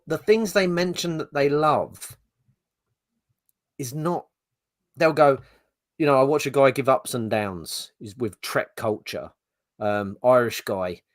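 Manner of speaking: ordinary talk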